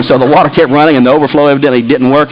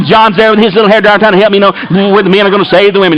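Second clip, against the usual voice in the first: normal talking voice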